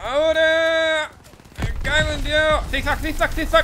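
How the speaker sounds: high, loud mid-dash shouts; urgent yelling. Real, not acted. Player zigzagging through gunfire, urgent high yell. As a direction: shout it while dodging bullets.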